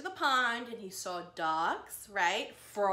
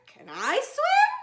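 higher, louder, slow pleading little voice; eager Digger; 14 semitones higher, 11 dB louder, slower than usual. Acted. Digger asks Daisy to swim, high slow pleading voice. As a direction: beg to swim like a small child.

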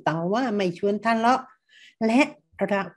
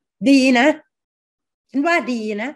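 insincere praise; 4 semitones higher, 8 dB louder, slower than usual. Shown as a demonstration. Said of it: "เชื่อไหมคะว่ามันดี".

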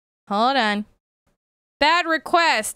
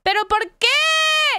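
high-pitched cry of comic despair; melodramatic wail; 13 semitones higher, 3 dB louder than usual. Pretend. Scene: streamer lamenting a broken game, high hammed-up wail. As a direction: wail it like a tragedy.

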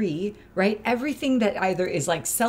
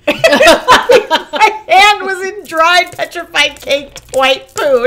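high, loud, slow words through helpless laughter; laughing; 9 semitones higher, 14 dB louder, much slower than usual. Real, not acted. Host telling her co-host about the poo, laughing hard. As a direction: wheeze it out cracking up.

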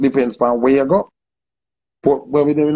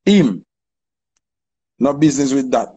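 usual speaking voice

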